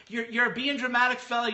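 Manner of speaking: mocking imitation. Retelling Joe's reply as absurd.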